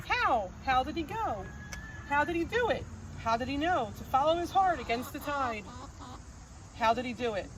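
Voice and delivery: ordinary delivery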